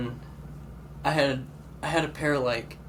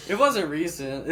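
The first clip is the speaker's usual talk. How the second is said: laughing; 10 semitones higher, slower than usual. Real, not acted. Laughing before story.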